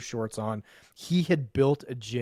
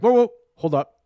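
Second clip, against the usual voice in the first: interrupting; 7 semitones higher, 11 dB louder than usual. Retold or imitated. Cop interrupts long monologue.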